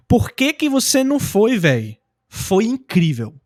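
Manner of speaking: "Por que que você não foi" high and slow, dropping on "véi"; demonstrated intonation. Shown as a demonstration. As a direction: stretch "incrível" slowly, falling at the end. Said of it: "funciona a mesma entonação?".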